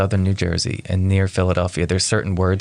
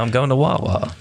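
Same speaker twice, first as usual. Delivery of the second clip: put-on South Jersey accent; 4 semitones higher than usual. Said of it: "I'm from South Jersey".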